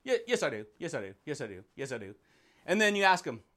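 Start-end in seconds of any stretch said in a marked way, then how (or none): 0.0-2.2 a stubborn voice that fades lower and softer with each yes; the ego answering. Retold or imitated. An ego insisting it knows, fading off. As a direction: insist yes, then let it sink away. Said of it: "The ego says".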